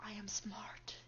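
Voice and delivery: breathy secret whisper. Pretend. Playful boast after a gasp.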